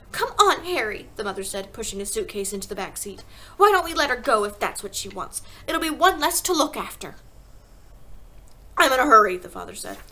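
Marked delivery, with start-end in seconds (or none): 0.1-1.1 high, loud call to hurry; sharp, raised wifely urging; impatient mother. A narrator's reading. Mother urging her husband. 3.6-7.1 dismissive mother. A narrator's reading. Mother voiced in story. 8.8-9.4 loud, brusque announcement; hurried, forceful dad; impatient father. A narrator's reading. Father voiced in story.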